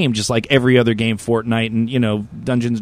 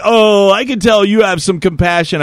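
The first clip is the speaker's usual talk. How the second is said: high, louder mock sweetness; imitating canvasser; 7 semitones higher, 4 dB louder than usual. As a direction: ham up a pushy canvasser's fake warmth. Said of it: "he's like".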